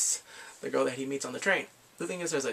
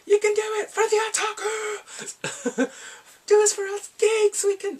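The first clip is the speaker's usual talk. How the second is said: loud, very high falsetto rallying cry; over-the-top cheering; 6 dB louder, slower than usual. Retold or imitated. Three geeks urging him on, hammed-up cheering. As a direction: shriek encouragement like a hammed-up fan.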